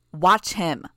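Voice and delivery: loud sharp barked command; barked order. A narrator's reading. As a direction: bark it as an order. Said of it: "I barked the order".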